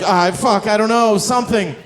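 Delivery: mock flustered. Flustered imagined reply.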